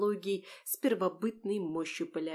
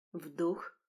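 whispered to oneself; 2 semitones lower, 5 dB quieter than usual. A narrator's reading. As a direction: murmur it softly to yourself. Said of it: "прошептала я сама себе".

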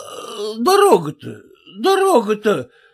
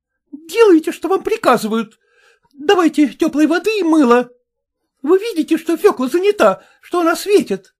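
high, quick, impatient commands; scolding orders; 7 semitones higher, 3 dB louder, faster than usual. A narrator's reading. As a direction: order the husband about crossly. Wife orders her husband about.

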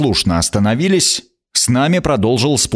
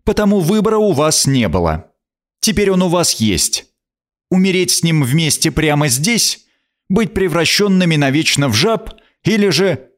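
stern, threatening queen, higher; harsh; 6 semitones higher than usual. Acted. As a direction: menace them with the choice. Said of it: "произнесла она жёстко".

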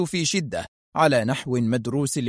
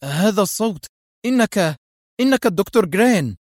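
higher, halting cry of recognition; astonished exclamation; 6 semitones higher than usual. A narrator's reading. Director recognises the impostor's voice, astonished. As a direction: exclaim it haltingly as realisation dawns. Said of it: "وهو يهتف".

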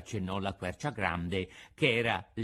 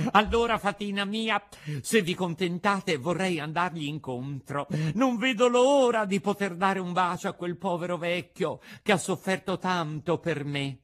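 high, loud, eager puppet voice; overjoyed puppet; 7 semitones higher, 5 dB louder than usual. A narrator's reading. Puppet begs fairy to go meet father, overjoyed. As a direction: gush with joy as the puppet. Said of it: "saltando dall'allegrezza".

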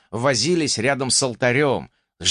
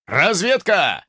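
shouted command; 5 semitones higher, slower than usual. Acted. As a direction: bark the order out. Chief orders scouts forward.

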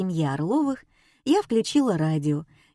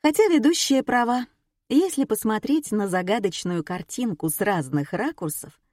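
usual speaking voice

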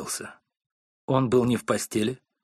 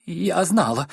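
sobbing; 6 semitones higher, slower than usual. A narrator's reading. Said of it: "всхлипнула миссис Корнер".

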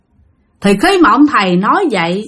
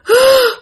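sudden high gasp for air; sharp gasp; 3 dB louder than usual. Retold or imitated. Possessed disciple gasping for air, sharp high gasp. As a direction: suck in a startled breath. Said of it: "tự nhiên ngồi lấy hơi lên".

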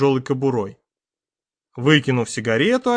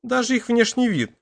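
usual speaking voice

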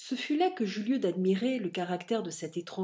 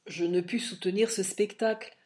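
plain reading voice

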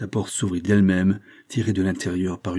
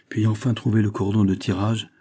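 usual speaking voice

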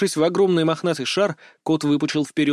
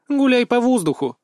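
higher, indignant retort from the captain; 9 semitones higher, 4 dB louder than usual. A narrator's reading. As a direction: snap back with mild indignation. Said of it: "возмутилась капитанша". (возмущённо)

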